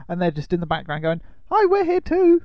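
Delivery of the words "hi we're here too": high, small voice begging for notice; tiny plaintive voice; squeaky little voice. Acted. Films voiced as ignored bystanders.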